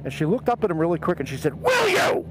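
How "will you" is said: sudden high-pitched shouted demand; imitated mother's shout. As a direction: play a mother yelling at her son. Mother turns sharply on her son.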